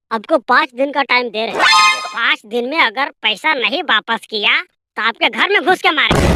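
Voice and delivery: ordinary delivery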